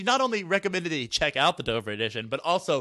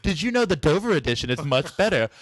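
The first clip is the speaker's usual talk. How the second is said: higher, louder, chirpy interruption; mock breezy author; 3 semitones higher, 5 dB louder than usual. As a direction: play a busybody author butting in on diners. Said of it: "she leans over and she's like".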